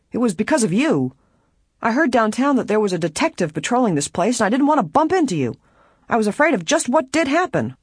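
high-pitched, pleading suspect; defensive prisoner. A narrator's reading. Prisoner offers the guard witnesses for an alibi, defensive.

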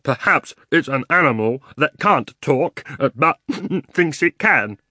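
bright donkey. A narrator's reading. Donkey offering an idea to the animals, loud and bright. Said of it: "said the donkey brightly".

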